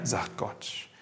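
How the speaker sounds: usual speaking voice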